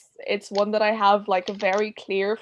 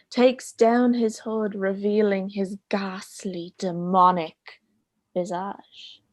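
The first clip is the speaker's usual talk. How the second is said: story narration. A narrator's reading. Tells a ghost story.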